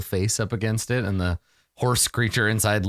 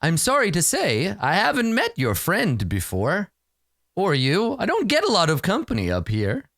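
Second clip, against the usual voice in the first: higher-pitched, theatrical creature voice; courteous unicorn; 8 semitones higher than usual. Acted. Lonely unicorn apologizes to visitors, high courteous voice. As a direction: apologize politely in a high, lilting voice. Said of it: "and says".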